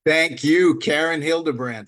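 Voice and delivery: normal talking voice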